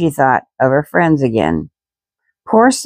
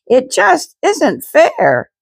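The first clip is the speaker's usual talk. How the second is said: much higher, slow wail of protest; crying out; 11 semitones higher, much slower than usual. A narrator's reading. Girl protests to family mid-story, high slow wail. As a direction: cry it out, near tears. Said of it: "she cried".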